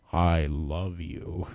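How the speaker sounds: low, dragged-out creepy toy voice; slow creepy doll. Retold or imitated. Husband imitates haunted doll in the closet, low and slow. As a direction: mimic the doll going off alone. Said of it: "you'll hear it say".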